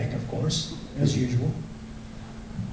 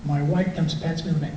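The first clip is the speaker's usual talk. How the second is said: ordinary delivery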